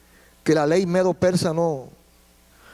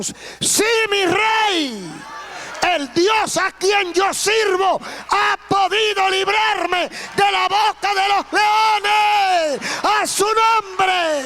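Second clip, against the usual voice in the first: triumphant shout; 11 semitones higher, much slower than usual. Acted. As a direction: call up from the pit in joy. Daniel answers the king from the pit.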